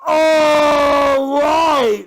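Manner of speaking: long, high, wailing shout; drawn-out high yell; loud outburst. Real, not acted. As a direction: bellow it at the top of your voice. Outburst while reacting to watch.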